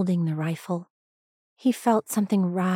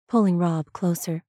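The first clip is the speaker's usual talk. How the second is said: usual speaking voice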